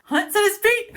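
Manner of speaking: shrieked high and loud in excitement; overexcited shout. Real, not acted. Reader thrilled by the fight stance, excited shout. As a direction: shout the line as if you can't contain it. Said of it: "This is too exciting for my brain".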